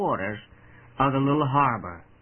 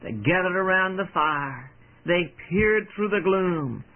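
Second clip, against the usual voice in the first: plain reading voice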